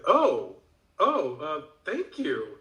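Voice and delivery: high swooping "oh", settling down; surprised thanks. Retold or imitated. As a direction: imitate someone taken aback and grateful. Retold reaction to unexpected help.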